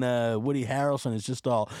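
ordinary delivery

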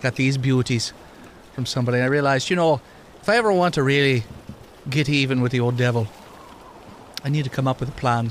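put-on mildly Irish-tinged lilt for an old immortal NPC; Wisconsin Irish accent. GM voicing the immortal Tom to the players, light Irish brogue. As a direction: keep a light Irish brogue, wry and storytelling. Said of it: "He sounded like he was a Wisconsin Irishman".

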